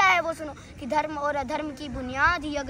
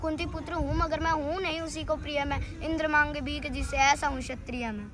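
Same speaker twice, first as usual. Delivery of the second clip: ordinary delivery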